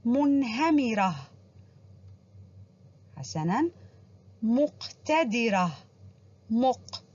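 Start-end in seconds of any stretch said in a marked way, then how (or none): 0.0-1.2 منهمرة drawn out slowly, ending in h, munhamirah; slow model reading of منهمرة with the final h; pausal ta marbuta. Shown as a demonstration. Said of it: "عند الوقوف عليها لفظت هاء". 4.4-5.7 مقتدرة slow, with a firm qaf, muq-tadirah; syllable-by-syllable مقتدرة, qaf clearly articulated; stress the qaf. Shown as a demonstration. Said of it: "ما هذا الحرف أحبائي الملون بالأحمر".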